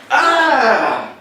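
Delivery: sharp high scream of fright; startled scream. Acted. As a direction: shriek in sudden fear. Startled by the villain's arrival.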